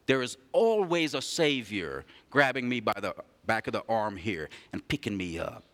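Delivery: usual speaking voice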